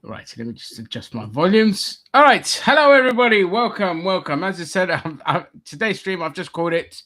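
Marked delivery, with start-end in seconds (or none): 2.1-4.4 loud, high, fast greeting in own accent; excited greeting. Real, not acted. Presenter restarts the show, real excited greeting. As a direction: greet the audience with a burst of energy. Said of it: "last time I'm doing that bloody accent".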